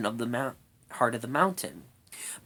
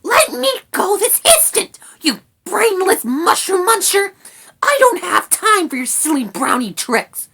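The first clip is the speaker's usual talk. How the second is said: very high, loud furious rat voice; shrieky, outraged little creature; indignant rat; 9 dB louder than usual. A narrator's reading. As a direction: scold at full pitch like a tiny captive. Captured rat scolds the brownie.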